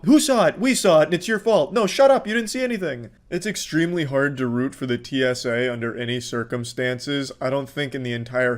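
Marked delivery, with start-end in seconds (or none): 0.1-3.1 Karen and crowd. Retold or imitated. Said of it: "You know".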